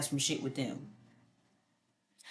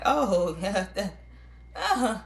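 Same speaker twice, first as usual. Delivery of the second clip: high, slow, sugary fake laugh-talk; hammed-up phony amusement; fake amused; 6 semitones higher, slower than usual. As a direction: play along with a joke you don't find funny. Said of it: "someone who is acting like".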